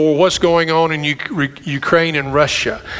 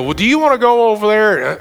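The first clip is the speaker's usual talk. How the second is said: higher, quick mock question; sounds like a question; 5 semitones higher, faster than usual. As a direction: imitate a lady's seemingly innocent question. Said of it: "they sound like questions".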